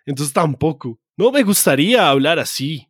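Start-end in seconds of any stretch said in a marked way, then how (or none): 1.2-2.9 neutral Spanish documentary-dub accent; a hint of neutral dubbed-Spanish accent; Discovery Channel accent. Shown as a demonstration. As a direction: lightly imitate a Discovery Channel dubbing accent. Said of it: "no tener acento es acento de Discovery Channel".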